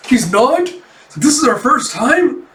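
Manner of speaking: high, rising shocked questions; shocked disbelief. Acted. Cafe owner learns it is her first time, shocked. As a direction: shoot both questions up in alarm.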